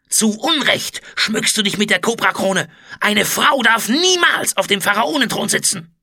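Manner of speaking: high, loud, strained denunciation; hateful defiance. A narrator's reading. Food taster denounces the female pharaoh, hateful outburst. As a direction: hurl contempt up at the throne. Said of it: "zischte er voller Hass".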